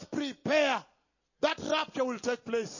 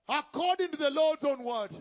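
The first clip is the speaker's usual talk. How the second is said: normal talking voice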